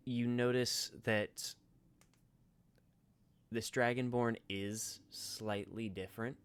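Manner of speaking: measured descriptive narration; game master narration. Acted. GM describes scene.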